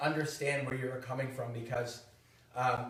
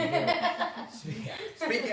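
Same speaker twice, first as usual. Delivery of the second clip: laughing; 9 dB louder, much slower than usual. Real, not acted. Laughing at cape joke.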